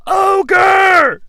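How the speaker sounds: high, loud party cheer; crowd yell. Retold or imitated. Frat crowd cheering the Ogre as he walks in, high and loud. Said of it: "his mom's funeral".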